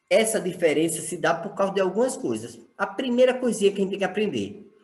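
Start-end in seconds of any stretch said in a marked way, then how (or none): none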